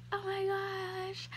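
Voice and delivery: high, soft, slow awestruck sigh; awed gasp. Retold or imitated. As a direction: breathe it out in disbelief. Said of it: "and I was like".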